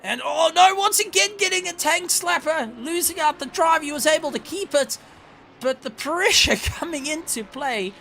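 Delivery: oh no, he's losing it; excited. Real, not acted. Car loses control live.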